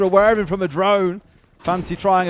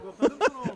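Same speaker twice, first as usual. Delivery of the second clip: amused laugh; 9 semitones higher, 5 dB quieter than usual. Real, not acted. Laughs at own joke.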